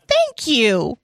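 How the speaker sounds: a hammed-up flattered girl; mock flattered thanks. Pretending to thank Donald at the bar, mock coy. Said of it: "And I'll be like".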